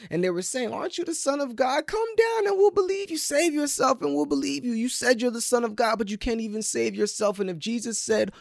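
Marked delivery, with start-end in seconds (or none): 0.7-7.4 high, loud mocking dare; scornful crowd goading the crucified man; jeering crowd. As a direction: taunt him to come down. Preacher voices crowd mocking Jesus.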